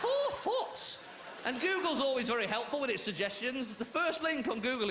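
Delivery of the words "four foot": high laughing squeal of disbelief. Real, not acted. Comic to crowd, squealing four foot through laughter. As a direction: laugh it out in shock. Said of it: "I'm laughing now".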